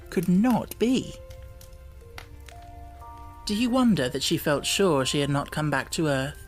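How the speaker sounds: ordinary delivery, nothing marked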